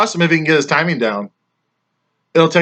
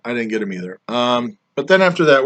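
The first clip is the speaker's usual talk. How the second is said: ordinary talk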